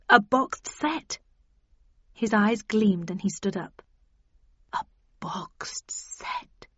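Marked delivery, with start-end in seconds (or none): 0.1-1.2 slow, higher, dangled offer; tantalising build-up. A narrator's reading. Tempts Jack with grander prospect. 4.7-6.7 very slow, hushed whisper; whispered awe. A narrator's reading. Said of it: "he whispered".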